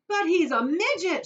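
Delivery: high, loud, slow outcry; father shocked at tiny son; shouted. Acted. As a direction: shout in dismay. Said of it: "shouted Mister T V".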